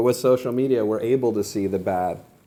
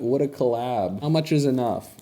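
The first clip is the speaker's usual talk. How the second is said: ordinary talk, nothing marked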